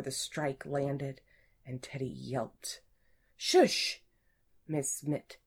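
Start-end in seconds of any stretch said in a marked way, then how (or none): 3.4-4.0 sharp, high, loud hushing; chastising. A narrator's reading. Supervisor warns Teddy not to get caught, chastising. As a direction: hush him with a sudden high burst.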